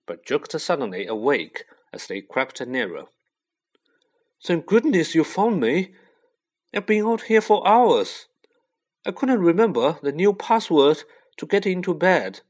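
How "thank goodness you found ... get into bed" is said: high, loud, flustered relief; worked-up plaintive voice, raised throughout; relieved outburst. A narrator's reading. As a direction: blurt it out to your rescuers. Stranded Neville is found at last.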